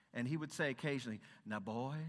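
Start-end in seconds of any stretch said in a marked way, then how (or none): 1.5-1.9 gentle Southern drawl, a little low; lightly imitated genteel Southern gentleman; genteel Southern accent. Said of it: "that's a, that's a genteel Southern accent".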